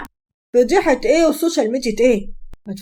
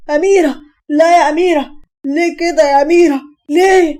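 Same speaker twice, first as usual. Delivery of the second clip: high, loud, horrified calling of her name; shocked frightened cry; 5 semitones higher, 6 dB louder than usual. A narrator's reading. Brother screaming after his sister jumps, horrified shout. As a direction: cry out in terror as she falls. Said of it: "هشام بصدمة وخوف".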